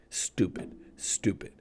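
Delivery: breathless whisper, dropping away; whispered repeat. Real, not acted. Vlogger fuming about the firing, whispered. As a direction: let the word fade to a whisper.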